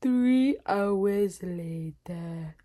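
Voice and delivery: very slow drawn-out narrator voice; SpongeBob narrator. Shown as a demonstration. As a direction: stretch every word like a cartoon time card. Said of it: "Did that sound like".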